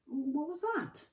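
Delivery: breaks off high and slow, alarmed; startled mid-sentence. Acted. McGonagall startled by a rumble in the street, breaking off slow and high. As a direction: stops short as a strange rumble starts.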